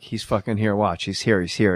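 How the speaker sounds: low aside. Retold or imitated. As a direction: drop your pitch as if tipping someone off. Nervously confiding that Norm is present.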